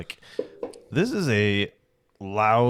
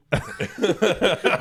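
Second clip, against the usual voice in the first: high burst of laughter; 9 semitones higher than usual. Real, not acted. Cracking up at his own joke.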